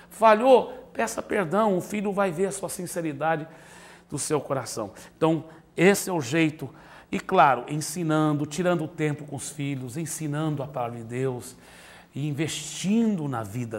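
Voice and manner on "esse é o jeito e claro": ordinary talk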